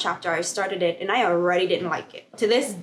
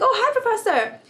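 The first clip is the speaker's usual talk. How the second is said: surprised hello; 14 semitones higher, 4 dB louder than usual. Retold or imitated. Surprised student greeting.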